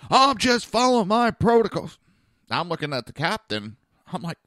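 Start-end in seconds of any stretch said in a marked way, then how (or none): 0.1-2.0 high, loud mocking whine. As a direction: parrot him like a smug rule-follower. Mocking the rule-bound medic.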